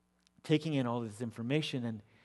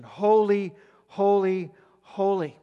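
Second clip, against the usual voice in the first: proclaimed cry; 4 semitones higher, 6 dB louder, slower than usual. Acted. Seraphim's cry quoted.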